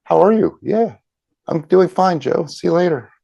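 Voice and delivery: high, loud, cheery passing greeting; breezy small talk. Shown as a demonstration. Shallow greeting to Joe, high and bright. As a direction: toss it off like a quick hello in the hallway. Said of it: "the outer shell of".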